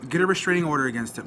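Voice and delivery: fast, dismissive mimicry of the friend's advice; rattled-off mock quote. Retelling the friend's advice to the viewers, rapid and dismissive. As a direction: rush it out like a brush-off. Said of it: "my quote-unquote friend says".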